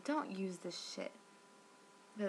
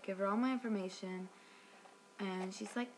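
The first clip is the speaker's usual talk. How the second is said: normal talking voice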